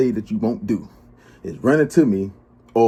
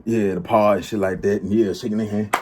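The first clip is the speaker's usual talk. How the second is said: usual speaking voice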